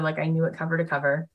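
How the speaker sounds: usual speaking voice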